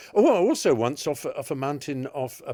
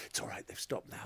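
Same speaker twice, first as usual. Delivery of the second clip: whispering; 13 dB quieter than usual. Retold or imitated. Hostess reassures with a grin.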